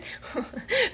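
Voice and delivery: high breathless laugh; stunned laugh. Real, not acted. Wife stunned by husband's answer, laughing breathlessly. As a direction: burst out laughing in shock.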